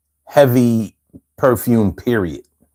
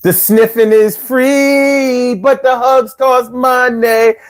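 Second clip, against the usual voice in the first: slow, drawn-out cat voice, higher; lightly voiced purring cat, slow; 5 semitones higher, much slower than usual. The cat rebuffs the would-be lover, slow put-on cat voice. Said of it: "That cat was like".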